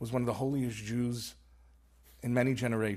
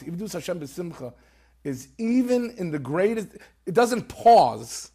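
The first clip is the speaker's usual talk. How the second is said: preaching fervor; 5 semitones higher, 11 dB louder than usual. Real, not acted. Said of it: "preaching climax".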